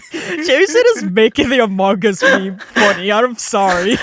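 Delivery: laughing through the words; cracking up mid-sentence. Real, not acted. Laughing fit after scream.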